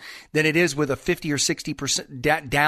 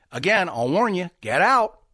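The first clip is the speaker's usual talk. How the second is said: ordinary talk, nothing marked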